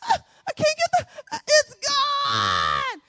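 son's squealing scream, cracking and failing; imitated high-pitched screams. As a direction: mimic a boy straining for very high screams. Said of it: "he likes to have these high-pitched screams".

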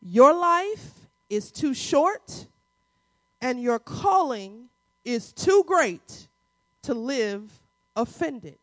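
very slow, phrase by phrase, with weighty pauses; drawn-out, deliberate statement for note-takers; emphatic repeat. Shown as a demonstration. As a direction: spell out the key statement slowly, letting each part land. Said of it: "I'm gonna say that again".

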